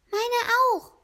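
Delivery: hoch gerufen, kleines Eichhörnchen; rufendes Eichhörnchen. A narrator's reading. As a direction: ein Eichhörnchen ruft hell und viel höher dazwischen. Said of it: "rief das Eichhörnchen". (Eichhörnchen ruft hoch und eifrig)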